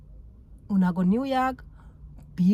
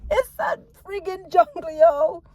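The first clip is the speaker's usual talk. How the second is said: laughing exasperation; 8 semitones higher, 3 dB louder than usual. Real, not acted. Laughing exasperation about New York.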